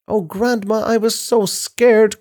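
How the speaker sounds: put-on high-pitched, upset child voice; scared cry. A narrator's reading. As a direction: cry it out high, stretching the fear.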